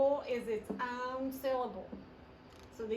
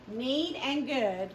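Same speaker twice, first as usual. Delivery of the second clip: Need and good said slowly and distinctly; slow and clear; 4 dB louder, much slower than usual. Shown as a demonstration. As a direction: stretch Need and good out for the kids. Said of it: "blends both words for class".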